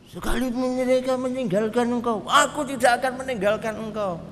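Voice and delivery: high mocking echo of Peter's vow; mimicking Peter's boast. Imagined Jesus mimicking Peter's vow, high and mocking. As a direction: parrot his promise back.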